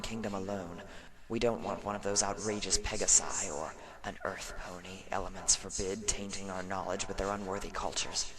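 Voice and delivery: soft, far-off hushed voices from the past; ghostly whispers drifting in from history; distant whispered echoes. Acted. Ancient unicorns overheard through the slab, soft distant whispers. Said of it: "echoes of voices surged".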